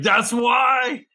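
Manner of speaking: high, loud cry of realization; high-pitched shout of sudden understanding; shocked shout. Retold or imitated. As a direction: yell it as it finally clicks. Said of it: "I was like".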